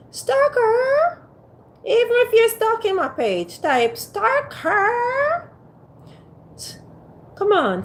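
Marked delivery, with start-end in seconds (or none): 0.1-1.3 stalker sung up high, teasing; high sing-song coax. Real, not acted. Begging her live viewers in a high teasing sing-song. 1.8-5.5 type stalker drawn out slow and high; slow high coax. Real, not acted. Pleading with silent viewers, slow and high.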